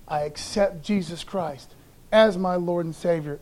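ordinary talk

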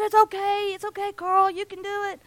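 high, sing-song encouragement to Carl; cheering encouragement. Acted. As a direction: cheer him up, pitched high. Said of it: "they said".

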